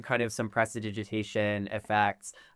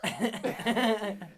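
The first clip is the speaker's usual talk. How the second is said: giggle; 9 semitones higher, 4 dB quieter than usual. Real, not acted. Giggle at playing goblins.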